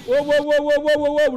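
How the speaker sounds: high, loud excited wows. Real, not acted. Streamer hyped as the trailer starts. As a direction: hype it up.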